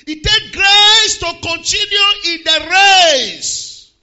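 slow, loud preacher shout; preaching. Acted. Preacher shouting slowly to the church. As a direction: drive it home to the congregation.

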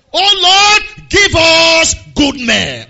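slow, loud, high prayer close; drawn-out shouted amen. Real, not acted. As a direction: stretch out the closing line as a cry. Leading prayer.

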